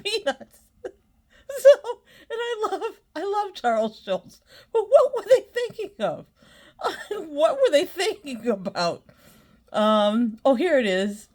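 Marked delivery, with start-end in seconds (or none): 0.0-9.0 high-pitched, squeaky with real laughter; incredulous, cracking up mid-sentence; laughing hard. Real, not acted. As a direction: gasp out disbelief between laughs. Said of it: "I'm laughing so hard".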